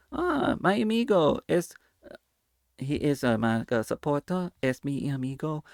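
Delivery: a hint of a Spanish-accented kids' host.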